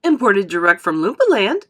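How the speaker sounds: loud, showy announcement; showman's pride; proud. A narrator's reading. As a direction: present it with a flourish. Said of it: "said Mister Wonka proudly".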